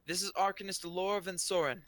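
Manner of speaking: high, loud formal introduction; ringing podium announcement; booming voice. Acted. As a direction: announce a dignitary to the crowd. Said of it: "the voice booms".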